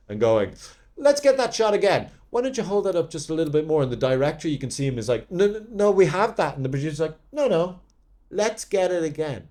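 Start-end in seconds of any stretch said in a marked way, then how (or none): 1.0-3.8 much higher, pushy mock producer; imitating the meddling producer, higher, fast end. Producer demanding retakes. 5.3-6.6 higher, louder protest; mock director pleading we have it; exasperated director. Director objecting. 7.3-9.5 higher mock insistence; imitating the producer overruling, higher; insistent producer.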